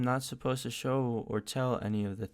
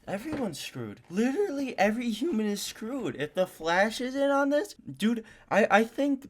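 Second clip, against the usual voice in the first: mock panic; 12 semitones higher, 4 dB louder than usual. Real, not acted. Panicked reaction to horror teaser.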